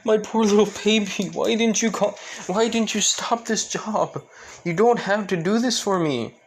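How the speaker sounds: high, loud pleading father's voice; father begging his daughter, mock tearful; crying father. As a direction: plead through tears as the dad. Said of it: "Alexis's dad started crying".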